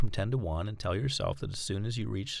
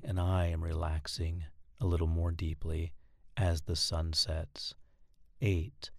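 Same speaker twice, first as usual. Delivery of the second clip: slow, steady, lower hypnotic monotone with long pauses; 3 semitones lower, slower than usual. Shown as a demonstration. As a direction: lull the listener down like a droning teacher. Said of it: "you want to talk slowly and in a monotone voice".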